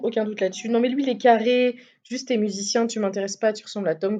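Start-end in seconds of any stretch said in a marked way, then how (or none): none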